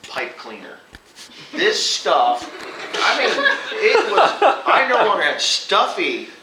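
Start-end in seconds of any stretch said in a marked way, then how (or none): 1.5-6.3 laughing through the words, loud; can barely get it out for laughing. Real, not acted. Farmer laughing while telling friends about the spray.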